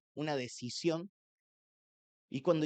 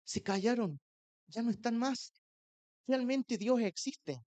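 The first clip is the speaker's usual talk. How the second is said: joyful relief; 6 semitones higher than usual. Retold or imitated. Freed man tells friends the voices stopped, in high amazed joy. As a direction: lift it up in wonder.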